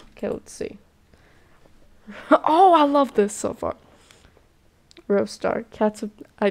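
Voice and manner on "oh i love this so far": higher and louder, laughing as she gushes; laughing delight. Real, not acted. Gamer delighting in the game's art, laughing excitedly.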